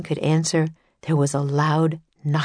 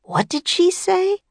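put-on high, slow old man straining to hear; hard of hearing. A narrator's reading. Edison asking what Annie said, old man hard of hearing. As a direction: ask like an old man who missed every word. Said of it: "as if he were a little hard of hearing".

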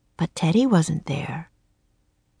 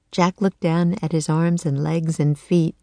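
usual speaking voice

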